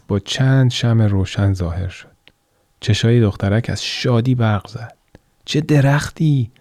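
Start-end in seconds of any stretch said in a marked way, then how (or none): 5.4-6.5 slow, high wonder; girl awed by the glowing Christmas tree; awed delight. Acted. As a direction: marvel at the tree. Girl sees Christmas tree vision.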